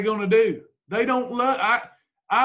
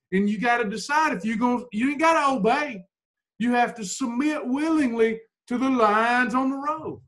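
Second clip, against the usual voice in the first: ordinary delivery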